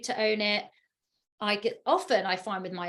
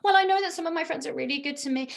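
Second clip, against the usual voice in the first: higher, fast voice of the eldest daughter; teen daughter voice; 6 semitones higher, 3 dB louder, faster than usual. Retold or imitated. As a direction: play the daughter calming down, quick and light. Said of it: "by the time she's finished her meltdown".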